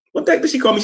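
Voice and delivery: sharp high indignant question; incredulous, voice jumping up high; outraged disbelief. Real, not acted.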